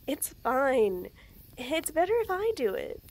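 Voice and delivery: usual speaking voice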